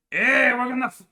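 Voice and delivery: high, loud hammed-up foreign voice; cartoon European soldier voice; mock accent slipping into French. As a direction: start a threat in a botched accent. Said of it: "French again".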